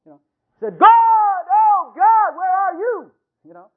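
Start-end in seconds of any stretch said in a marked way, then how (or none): 0.8-3.1 crying out high and loud to God; loud anguished cry. Retold or imitated. Preacher voicing Jesus crying out to God, loud anguished cry. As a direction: wail it up to heaven. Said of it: "heard for his loud cries".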